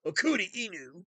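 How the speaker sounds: "Okuri Inu" in a put-on Japanese accent; mock Japanese voice. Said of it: "Let me say it one more time".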